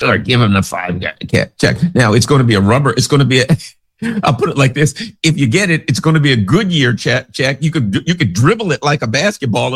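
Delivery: usual speaking voice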